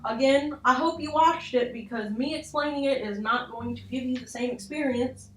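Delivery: ordinary delivery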